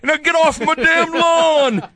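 put-on high, slow yell at the kids; cranky old man shouting from the porch; curmudgeonly old man voice. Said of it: "curmudgeonly old man voice".

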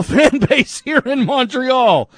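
playful excitement. Acted. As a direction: stretch the words out high with mock fanfare. Hams up listener's fan message.

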